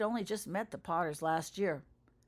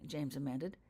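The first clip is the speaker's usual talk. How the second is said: ordinary delivery, nothing marked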